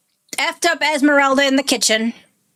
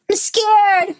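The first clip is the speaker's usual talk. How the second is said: put-on scared child; 8 semitones higher than usual. Child repeats fear, building.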